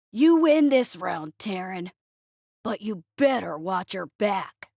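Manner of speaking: slow, menacing alien voice; alien villain. A narrator's reading. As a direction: growl a parting threat.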